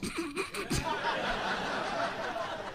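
mock host's high, soft laughing fit; helpless high laugh, put on; Robin Leach. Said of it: "Robin Leach isn't laughing".

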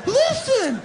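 very high, pleading mock partner voice; hammed-up needy partner pleading; clingy partner. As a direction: whine like a needy lover begging for reassurance.

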